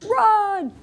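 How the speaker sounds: high shouted cry; run!. Shown as a demonstration. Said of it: "the pig has to do what?".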